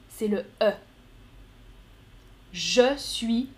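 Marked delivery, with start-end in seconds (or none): none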